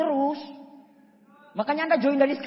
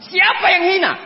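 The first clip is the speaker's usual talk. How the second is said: loud, high indignant shout; loud outraged question; shouted demand; 9 semitones higher, 10 dB louder than usual. Retold or imitated. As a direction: bark out who insulted us. Upline angrily demanding.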